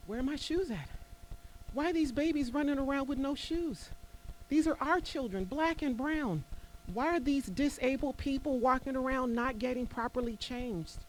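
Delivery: high, pleading and insistent; real indignation rising up high in the voice; impassioned plea. Real, not acted. Pleading for displaced families' needs.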